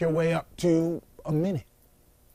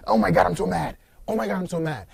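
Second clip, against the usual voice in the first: higher, forceful angry outburst, acted; mock mad; 5 semitones higher, 5 dB louder, faster than usual. Coach showing clients what to do when really mad, mock angry. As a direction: burst out loud, then repeat it pitched up. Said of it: "If you're really mad, go".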